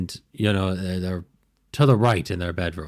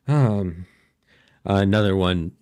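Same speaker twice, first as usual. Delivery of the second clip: ordinary delivery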